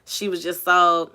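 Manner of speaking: ordinary talk, nothing marked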